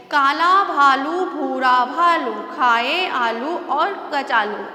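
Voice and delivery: slow rhyme, each long ऊ in भालू and कचालू leaned on; slow stressed rhyme. Shown as a demonstration. Teacher chants a rhyme slowly to show pupils the long ऊ. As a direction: chant it slowly, pressing the ऊ sounds. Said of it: "थोड़ा हमें जोर देना पड़ता है".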